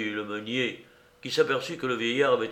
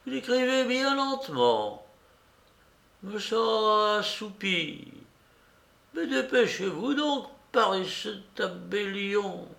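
put-on faint old beggar; 10 semitones higher than usual. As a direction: grumble drowsily in a thin high voice. Dying beggar complains to notary.

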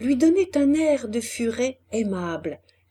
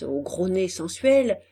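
ordinary delivery, nothing marked

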